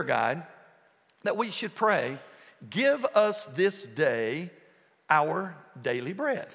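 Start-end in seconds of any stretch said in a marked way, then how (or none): 2.7-6.5 very slow, deliberate quote; slow scripture reading. Acted. Preacher reading the verse to the congregation very slowly. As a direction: read it out word by word.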